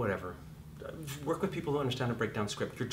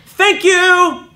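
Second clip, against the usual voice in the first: dismissive shout; 19 dB louder, much slower than usual. Acted. Director dismissing actor.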